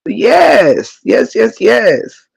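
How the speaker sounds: usual speaking voice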